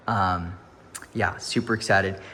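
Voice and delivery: ordinary talk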